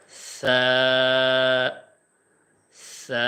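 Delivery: light soft sa held one second; soft thin sa. Shown as a demonstration. Teacher showing students the soft Arabic sa. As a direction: breathe out a thin gentle sa. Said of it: "নরম করে, হালকা করে".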